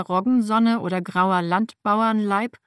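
usual speaking voice

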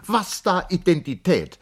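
high, loud indignant outburst; angry cry. A narrator's reading. Charlotte furious at the servant's fussing, high and loud. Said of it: "rief Charlotte erzürnt".

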